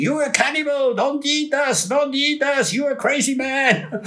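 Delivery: hammed-up panicked yelling at the cannibal man; imitating wild band members shrieking; mock screaming. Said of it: "were screaming".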